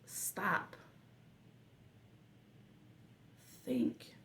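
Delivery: slow soft whisper, like calming yourself. Retold or imitated. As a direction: breathe the words out, barely voiced. Self-instruction in retold crisis.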